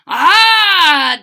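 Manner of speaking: high, very loud sharp "Ah!"; high piercing exclamation; sharp leprechaun cry. A narrator's reading. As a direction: bark it out sharply, a tiny man's shout. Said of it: "declared the leprechaun sharply".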